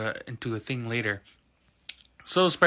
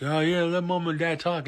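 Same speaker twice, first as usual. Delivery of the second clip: higher-pitched hero quip; Spider-Man; 5 semitones higher than usual. Retold or imitated. Hero quipping during the villain's attack, raised mock voice. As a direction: put on a cocky hero voice, pitched up. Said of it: "Spider-Man was like".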